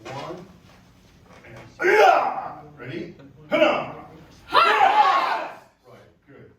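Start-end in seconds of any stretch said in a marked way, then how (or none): 0.0-2.6 slow count then a high yell; demo kiai. Shown as a demonstration. Instructor demonstrating the turn for students, ending in a yell. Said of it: "now when we turn around". 3.5-6.1 very high full yell; kiai shout. Real, not acted. Instructor yelling the kiai with the class, very high. Count, then yelled kiai.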